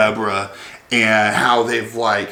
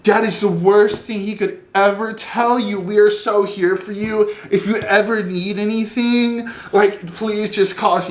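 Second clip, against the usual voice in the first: put-on high, over-the-top sympathetic girlfriend voice; mock the ladies fussing over her, much higher; gushing girlfriends; 8 semitones higher than usual. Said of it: "they're like".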